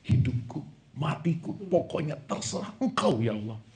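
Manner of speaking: soft, murmured mock prayer; hushed mocking petition; mock pleading prayer. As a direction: mumble a lazy prayer to God. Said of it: "Bu, Pak ngomong".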